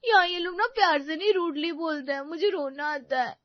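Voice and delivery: tearful complaint to mother; weepy grumbling; crying. Acted. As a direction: whine through tears. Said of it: "वो भी ऐसे रोते हुए".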